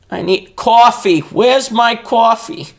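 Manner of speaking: high, loud, drawn-out coffee whine; mock coffee craving. Vlogger jokingly demanding a coffee, mock-desperate whine. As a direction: wail for coffee, high and hammed-up.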